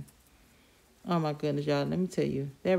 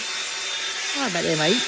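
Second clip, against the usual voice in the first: a hint of a British accent, slowed; mock British accent; 4 dB louder than usual. Apologising to viewers in mock British accent. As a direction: toss off a jokey British "mate".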